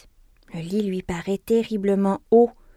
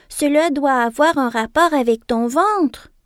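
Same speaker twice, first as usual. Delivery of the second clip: excited; 6 semitones higher, 7 dB louder than usual. Acted. Kangaroo keeps the excited voice.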